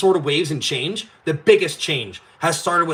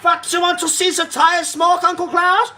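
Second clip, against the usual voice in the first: much higher, slow, put-on goading voice; taunting Uncle Klaus at his house, high mock voice; 12 semitones higher, 4 dB louder than usual. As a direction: goad him in a silly high voice. Taunting outside Schwab's house.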